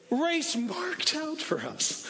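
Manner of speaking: slow, drawn-out close to the cry; high preaching voice, very slow. Acted. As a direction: land the last words slowly. End of quoted passage.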